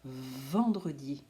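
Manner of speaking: "Vendredi" with a long buzzing "Vvv" at the start; "Vendredi" slowed, its first consonant held; exaggerated first sound. Shown as a demonstration. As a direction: stretch the V opening "Vendredi", slow and low. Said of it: "d'exagérer le début".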